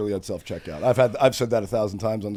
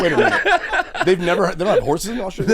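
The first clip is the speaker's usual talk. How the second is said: higher, louder, laughing in disbelief; surprised laughing; 6 semitones higher, 6 dB louder than usual. Real, not acted. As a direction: gasp it out in amused disbelief. Surprised at horse news.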